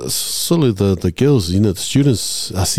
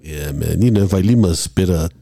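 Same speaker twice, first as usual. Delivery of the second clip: ordinary talk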